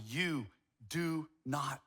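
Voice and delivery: low, slow stern warning; deep stern voice. Retold or imitated. Grandfather coaching daughter on a stern tone, deep and slow. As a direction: drop it down and lay down the law. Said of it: "the tone has to get deep".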